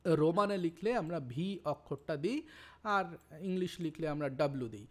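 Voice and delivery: normal talking voice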